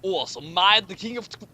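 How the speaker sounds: ordinary delivery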